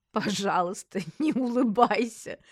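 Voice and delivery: words breaking up with laughter; laughing as you speak; through laughter. A narrator's reading. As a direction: plead while laughing. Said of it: "сквозь смех сказала я".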